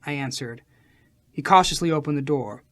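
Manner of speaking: plain reading voice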